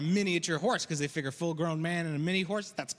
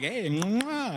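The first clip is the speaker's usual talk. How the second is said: producer's horse cue. Retold or imitated. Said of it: "He's like".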